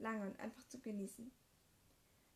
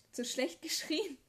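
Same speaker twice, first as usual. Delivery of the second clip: laughing; 5 semitones higher, 7 dB louder, slower than usual. Real, not acted. Laughs about her shouting.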